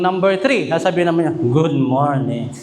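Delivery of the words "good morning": much lower, slow good morning in a booming bass; low, slow bass mock greeting; third man's voice. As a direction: play a very deep-voiced man greeting. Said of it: "iba-iba yung boses".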